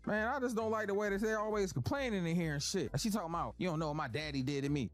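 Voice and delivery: Madea ranting. Acted. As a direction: lecture them like a fed-up grandma. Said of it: "Madea rants in class".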